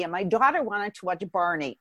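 ordinary talk, nothing marked

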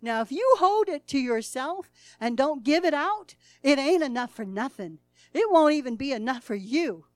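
loud, rising sermon fervor; preaching at full force. Acted. Preacher urging the church to give, loud. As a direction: drive it home to the congregation.

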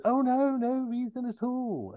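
very high, airy feigned innocence; Austin Powers. Acted. Austin backing away from the question, mock-innocent. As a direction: brush it off high and light. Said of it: "And Austin".

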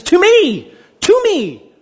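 the Greek emphasis, shouted high; emphatic. Shown as a demonstration. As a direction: proclaim To me! with full force. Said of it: "In the Greek, this is emphatic".

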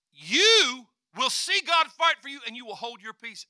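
loud shouted promise; preaching shout. Acted. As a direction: shout it to the congregation.

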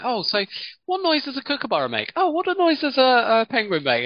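high, bouncy host voice; mimicking Cameron. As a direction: tease like an eager presenter. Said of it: "he's like".

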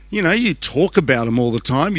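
ordinary talk

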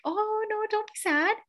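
put-on high, sing-song coo to a small child; imitating a parent soothing a child in a high voice. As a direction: coo it like a parent hushing a little one. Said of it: "I'd be like".

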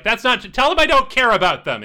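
high, loud indignant denial from behind; Savitri. Acted. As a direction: cut in loudly, insisting you don't care. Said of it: "And she's like".

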